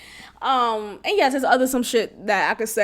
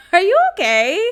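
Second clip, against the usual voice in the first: incredulous are you okay, pitched up and louder; mock concern; 6 semitones higher, 6 dB louder than usual. Mocking the ex with fake concern. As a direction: needle him with fake concern.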